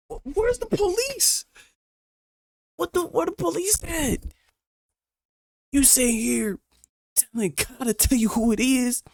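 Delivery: loud, high, furious demand; angry outburst. Real, not acted. Streamer yelling at the woman in the story, furious. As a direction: yell it in disbelief.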